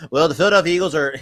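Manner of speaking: fast, mock newscaster delivery; lightly imitating a TV sports reporter. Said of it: "The reporter voice".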